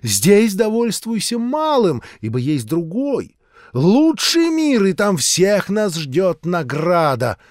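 high, loud, slow preaching voice; fervent street-preacher sermon; zealous old preacher. A narrator's reading. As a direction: warn him of doom like a revival preacher.

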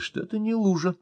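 ordinary delivery

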